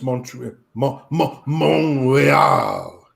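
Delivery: Montreal said the French way, mon-ray-al, restarted; French pronunciation. Shown as a demonstration. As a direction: stumble into a mock Québécois Montreal. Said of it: "'Cause you have to do that".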